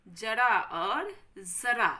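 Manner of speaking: जरा said first with plain ज, then जरा with a nukta z sound, slowly and loudly; slow teacher's demo of ja versus za; slow careful contrast. Shown as a demonstration. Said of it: "ध्यान से पढ़ते हुए बोलिए".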